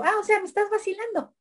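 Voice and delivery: high, quick mock indignation; talking to a rat. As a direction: tease the rat for fooling you. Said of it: "Le digo".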